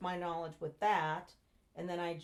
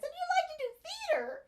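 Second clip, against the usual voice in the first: much higher, gushing "you like to do theater?"; excited question; 5 dB louder, faster than usual. Retold or imitated. Woman meeting her future husband at party, thrilled gushing question. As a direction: sound thrilled by the discovery. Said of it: "And I said".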